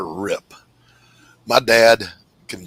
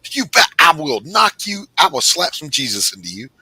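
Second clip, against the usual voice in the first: mock threat; 8 semitones higher, 6 dB louder than usual. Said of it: "grandfather the pastor's threat".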